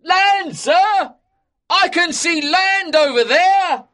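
high, very loud joyful shout. A narrator's reading. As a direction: yell out across the sea. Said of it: "gave a shout".

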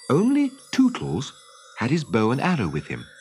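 ordinary delivery

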